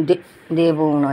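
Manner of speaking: low, slow sulky refusal দেবও না; stubborn pet voice. Pretend. Owner voices Mixi refusing to share, low and slow. Pet voice repeated plainly later.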